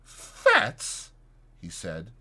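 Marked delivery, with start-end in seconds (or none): 0.0-1.1 very high, startled breath of a question; startled whisper. A narrator's reading. Doctor recognises his old acquaintance, startled whisper. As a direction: gasp the name in disbelief. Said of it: "in a startled whisper".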